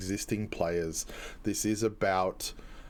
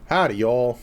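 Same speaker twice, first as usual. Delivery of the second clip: louder Southern US twang greeting; Southern accent; 6 dB louder than usual. Acted. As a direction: greet them like a Texan cowboy. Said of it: "If I just talk like this".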